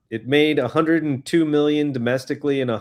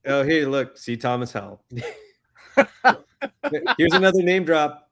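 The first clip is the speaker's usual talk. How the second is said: usual speaking voice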